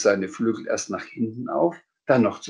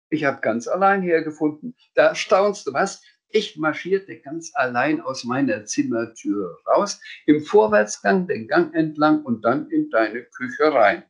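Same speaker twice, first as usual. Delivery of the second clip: higher, cheeky little creature voice; boastful Sams; 7 semitones higher than usual. Acted. Sams telling how it found the kitchen, higher boastful voice. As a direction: show off, lilting along.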